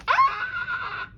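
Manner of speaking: very high happy shriek; shriek of joy. Real, not acted. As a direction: squeal when it clicks shut. Bracelet finally fits.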